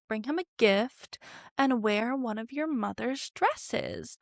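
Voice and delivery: hammed-up sly schemer, sweetly pushing; scheming father. Otto coaxing his daughter to visit the king, sly mock sweetness. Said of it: "Otto, professional schemer, is like".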